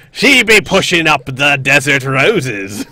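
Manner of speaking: slow pirate-speak line. Acted. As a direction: drawl it like an old pirate. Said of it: "I'll be talking in pirate speak".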